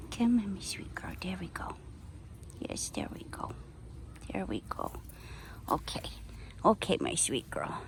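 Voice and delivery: very soft whisper to a pet; whispered coaxing. Real, not acted. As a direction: whisper gently to coax her along.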